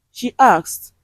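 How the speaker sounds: ordinary delivery, nothing marked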